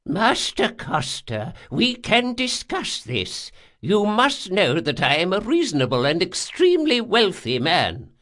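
put-on high, pleading old man's voice; anxious rich old man. Old man bargains with the intruder, high and anxious. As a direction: plead your case as a frightened old man.

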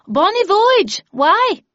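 high, loud reply from a passerby; calling back. Acted. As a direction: shout it back across the park. Passerby calls back full name.

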